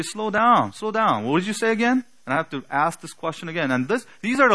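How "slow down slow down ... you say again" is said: calling to fast speaker. Retold or imitated. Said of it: "I would have to say".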